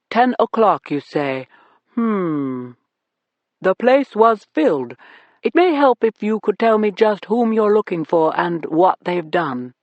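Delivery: put-on smooth, slow, deep British accent; a suave host in a deep British accent. A narrator's reading. Club owner welcoming the cops, in a deep British accent. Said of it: "deep British accent".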